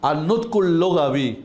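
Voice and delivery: slow, careful Arabic "আর নুতকুল লুগাবি"; Arabic pronunciation demo. Shown as a demonstration. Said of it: "আমার সঙ্গে বলেন". (ধীরে আরবি উচ্চারণে "আর নুতকুল লুগাবি")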